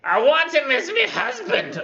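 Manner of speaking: very high, loud hag screech; crass Cockney hag. Acted. Hag claims the knight as her husband, shrieked Cockney hag voice. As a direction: shriek the demand like a triumphant crone. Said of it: "That's how she would talk".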